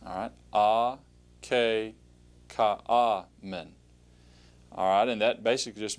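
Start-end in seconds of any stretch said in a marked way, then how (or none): 0.5-3.7 "A, K, Ka, A, Men" in measured, separated syllables; syllable-by-syllable pronunciation. Shown as a demonstration. Teacher repeats the Greek word for students, syllable by syllable. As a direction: repeat "A, K, Ka, A, Men" with a gap after each part. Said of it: "you can say it right after me".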